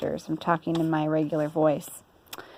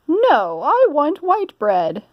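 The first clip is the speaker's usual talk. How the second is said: high, louder fussy lady voice; Missus Rogers; 8 semitones higher, 5 dB louder, much slower than usual. Acted. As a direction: bustle like a busy mistress. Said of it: "said Missus Rogers".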